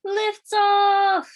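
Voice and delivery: high, loud triumphant cry; loud joyful shout of liftoff. A narrator's reading. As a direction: shout it as the rocket leaves the ground. Rocket launch cry.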